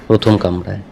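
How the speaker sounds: ordinary delivery, nothing marked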